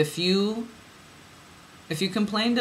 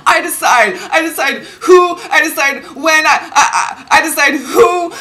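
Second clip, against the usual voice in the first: high, loud film-quote outburst, laughing; hammed-up, insistent movie heroine, high and loud; Pretty Woman outburst; 10 semitones higher, 8 dB louder than usual. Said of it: "Pretty Woman".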